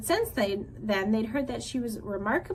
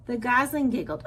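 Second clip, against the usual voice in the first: usual speaking voice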